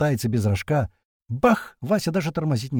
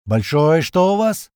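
urgent call; 4 semitones higher, 6 dB louder, slower than usual. A narrator's reading. Radio call under fire.

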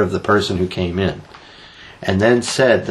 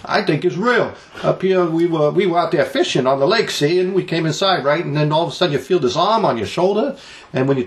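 high-pitched New England fisherman; Boston accent; 7 semitones higher than usual. Acted. Local telling of the ghost in the store, hammy Boston accent. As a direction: ham up a Boston or Maine local. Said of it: "that Boston type accent".

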